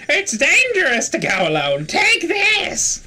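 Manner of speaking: high, loud put-on character voice; old game guide quote. Player quoting a game's helper handing over a weapon, high put-on voice. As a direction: declaim it high, leaping up on the last words.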